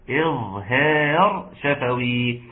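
slow "إظهار شفوي" with thin long vowels; careful light pronunciation. Shown as a demonstration. Teacher repeats the term for students, slow "إظهار شفوي" with a light long a.

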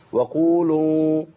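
"وقولوا" بقاف مفخمة وتدوير خفيف للشفتين; دوّر شفتيك قليلًا في "وقولوا"; rounded lips qaf. Shown as a demonstration. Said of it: "تدوير بسيطة أوي للشفتين". (تفخيم القاف في "وقولوا" بتدوير الشفتين)

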